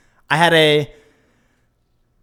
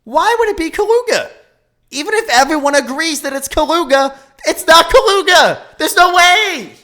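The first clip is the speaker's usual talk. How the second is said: high, loud exasperated rant; squealing outraged protest; mock outrage; 12 semitones higher, 6 dB louder than usual. Real, not acted. Ranting about pronunciation.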